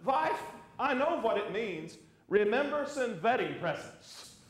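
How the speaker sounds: slow, deliberate Eastern European husband; thick Eastern European accent. Acted. Husband explains RSVP to wife, thick Eastern European accent. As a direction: deliver the punchline in an Eastern European accent, W turned to V. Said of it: "In his thick Eastern European accent".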